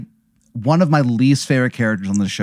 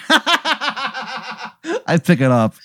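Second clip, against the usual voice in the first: real giggles running into the words; high giggling before speaking; laughing; 11 semitones higher, much slower than usual. Real, not acted. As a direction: laugh helplessly, then get the line out. Laughing at the toothpick twist.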